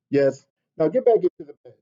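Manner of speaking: plain reading voice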